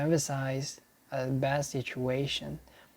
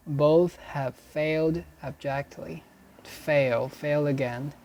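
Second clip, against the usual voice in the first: usual speaking voice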